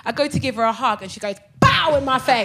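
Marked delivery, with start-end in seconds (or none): 1.6-2.0 loud, high "pow" of impact; sudden spoken thump of a landed punch; punch sound effect. Retold or imitated. Imitates the punch landing.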